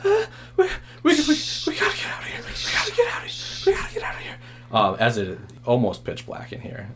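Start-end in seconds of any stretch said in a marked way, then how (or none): 0.0-4.4 put-on high frantic frightened kid; terrified, whispering to flee; panicked child. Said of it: "And Billy goes".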